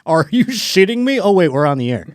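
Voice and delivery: mock announcer outburst. Announcer cursing live, then catching himself, mock alarm. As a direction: blurt it, then realize you're live. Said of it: "He's like".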